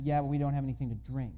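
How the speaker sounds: ordinary talk, nothing marked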